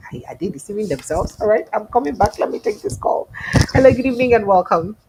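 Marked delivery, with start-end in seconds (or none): 3.7-5.0 loud greeting. Real, not acted. Host greeting a caller.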